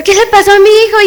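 high, urgent demand; acted alarmed father. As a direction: play a panicked parent questioning the doctor. Father questions doctor at hospital.

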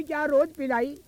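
ordinary delivery

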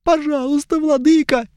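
put-on high, pleading young elf; whiny elf. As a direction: beg high and tearful.

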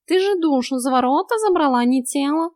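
high, scornful cat voice; snorting cat. A narrator's reading. Cat corrects heroine about soul and body, scornful. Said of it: "фыркнул кот".

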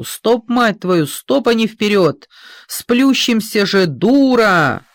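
slow, loud, high angry cursing; furious yelling. A narrator's reading. Driver curses assistant who pushed forward, furious yell. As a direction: curse him out to stop. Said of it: "орёт машинист помощнику".

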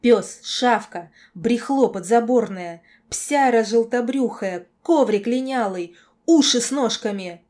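loud volley of insults; furious name-calling. A narrator's reading. Girl defying the lord's correction, furious name-calling. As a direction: fling each insult out loudly.

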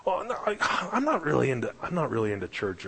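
high, hesitant demurral settling down; mock-flustered disclaimer; backing off. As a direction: imitate a man wriggling out of it. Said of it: "so he, he's backing off".

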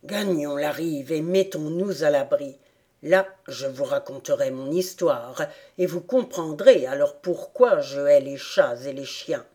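low-pitched rat voice with mild emotion; moved rat. A narrator's reading. Rat invites Alice ashore for his story, moved. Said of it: "il dit d'une voix émue".

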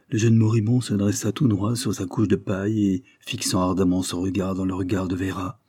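ordinary delivery, nothing marked

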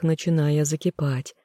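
plain reading voice

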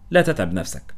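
usual speaking voice

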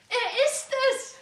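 high, loud, slow exclamation; excited cry. Acted. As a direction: cry it out with delight. Minna hears her uncle arriving.

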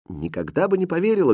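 high, plaintive sadness; mildly mournful disbelief; sad. A narrator's reading. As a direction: say it sorrowfully, a little quickly. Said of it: "грустно сказала Катринка".

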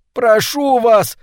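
high, drawn-out plea; desperate entreaty; begging. A narrator's reading. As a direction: beg for your life, stretching each word. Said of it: "взмолился Маклюр".

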